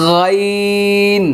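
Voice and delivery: গাইন held long and high, thick from the throat; slow heavy letter demo; heavy letter, lips straight. Shown as a demonstration. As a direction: stretch গাইন slowly with the lips kept straight. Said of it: "কোনো ধরনের গোল হবে না".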